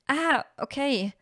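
high, slow, put-on surprise; feigned surprise. A narrator's reading. Miorin hiding what she knows from mother, feigned surprise. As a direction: act surprised, drawing it out. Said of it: "versuchte, überrascht zu wirken".

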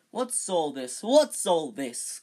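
booming. Acted. Lockhart arriving grandly.

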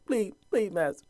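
high, put-on pleading voice; hammed-up pleading slave; begging. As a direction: beg the master desperately, mock-pitiful. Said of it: "begging, pleading".

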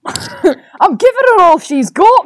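high, drawn-out Scottish engineer; Scottish accent. Acted. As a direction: play Scotty straining in a hammed-up Scottish accent. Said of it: "something along the lines of".

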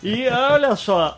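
normal talking voice

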